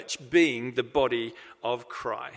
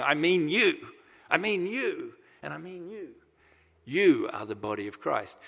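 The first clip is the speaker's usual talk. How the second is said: emphatic "I mean you", pointing out each person; 3 semitones higher than usual. Retold or imitated. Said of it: "It's like he's finger pointing".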